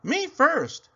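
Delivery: high, loud eager cry; cried out. Acted. Pig at story time cries high and loud. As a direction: demand to go first. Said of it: "he cried at story time".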